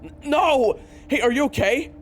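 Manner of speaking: high, loud panicked concern; shocked cry to fallen friend; alarmed cry. Acted. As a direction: rush to her in fear. Companion falls in pain.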